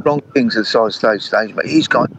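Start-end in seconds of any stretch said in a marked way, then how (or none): none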